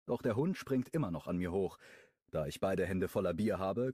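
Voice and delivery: ordinary delivery, nothing marked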